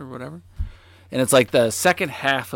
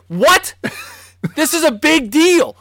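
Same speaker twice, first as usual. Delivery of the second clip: much higher, loud, far-off alarm; shocked disbelief; 13 semitones higher, 10 dB louder than usual. Retold or imitated. New kid reacting to rallies behind his house, loud shock. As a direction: panic at the news. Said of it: "I was like".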